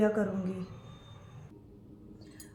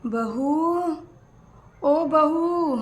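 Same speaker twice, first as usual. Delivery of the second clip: louder, higher call across the house; 3 semitones higher, 3 dB louder, much slower than usual. Acted. Old mother-in-law calls out for her daughter-in-law, loud and higher. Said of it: "क्यों चिल्ला रहे हो माँ जी इतना?".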